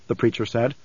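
plain reading voice

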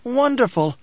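high-pitched, savoring delight; breathed in wonder. A narrator's reading. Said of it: "Skytail breathed".

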